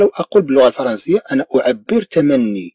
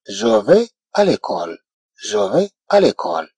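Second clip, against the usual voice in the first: slow, softer French; slow model phrase; 3 semitones lower, 3 dB quieter, much slower than usual. Shown as a demonstration. French teacher modelling a sentence, slowly. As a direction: read it slowly for the class. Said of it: "إذا أقول".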